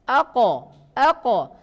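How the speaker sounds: heavy back ako; thick qaf. Shown as a demonstration. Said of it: "Kalau qaf tadi tebal".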